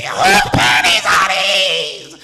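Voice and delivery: harsh, drawn-out accusing yell; mock screech. Girlfriend demands an answer about the underwear, mock screeching yell. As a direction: stretch it out as an outraged holler. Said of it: "she was like".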